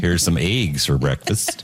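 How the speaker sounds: a hint of a folksy prairie uncle. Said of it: "like my uncle does".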